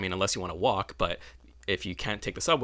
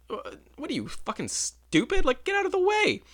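exasperated snap; 3 dB louder than usual. Retold or imitated. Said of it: "I'm like".

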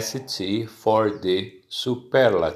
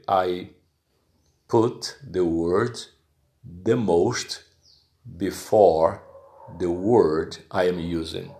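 normal talking voice

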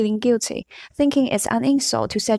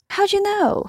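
a sharp, much higher challenge; 13 semitones higher than usual. Acted. Ron sharply questions Hermione, pitched high and sharp. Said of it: "said Ron very sharply".

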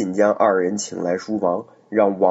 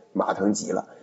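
ordinary delivery

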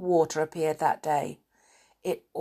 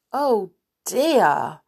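dismay; 3 semitones higher, 5 dB louder than usual. Acted. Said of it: "said Joe in dismay".

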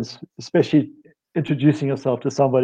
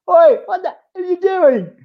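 high, loud Cockney shout of surprise; a startled Cockney bloke catching you mid-stretch; Cockney accent; 5 dB louder than usual. Retold or imitated. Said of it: "in his Cockney accent".

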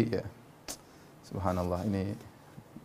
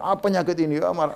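higher-pitched mock grumbling at an illness; angry complaint; 7 semitones higher than usual. Preacher imitating someone cursing their illness, mock angry. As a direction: act out an exasperated, angry sufferer. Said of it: "terkena penyakit kemudian marah".

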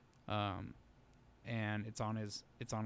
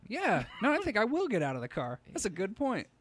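much higher, louder, starstruck and giggly; charmed driver; 12 semitones higher, 8 dB louder than usual. Pretend. Dazzled driver agreeing with the Aussie cop, high and giggly. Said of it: "You'd be like".

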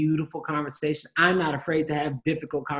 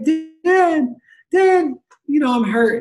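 put-on much higher, louder crying kid voice; crying child; 12 semitones higher, 9 dB louder, slower than usual. Hurt kid calling out to his dad, high and loud crying child voice. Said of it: "If your kid starts crying, like".

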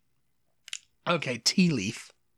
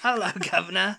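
higher-pitched caricature British greeting; mock British accent; 4 semitones higher than usual. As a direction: imitate an American's bad British accent. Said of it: "practicing their British accent".

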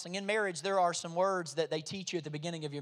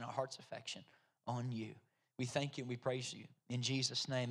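low, soft pastoral prayer; quiet, reverent address to God; hushed prayer; 4 semitones lower, 3 dB quieter than usual. Real, not acted. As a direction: pray gently with pauses between phrases.

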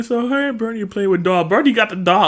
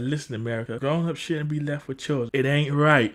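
lower, softer, tired; a touch of low weary; 5 semitones lower, 7 dB quieter than usual. Retold or imitated. Exhausted uncle complaining to America, low and quiet. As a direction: mutter it low and worn out. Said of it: "He's like".

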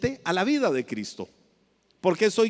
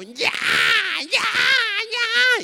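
put-on piercing high crying shout of a baby; imitated baby wail; 4 dB louder, much slower than usual. Preacher mimics a hungry baby crying for its mother. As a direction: wail it like a hungry newborn demanding milk.

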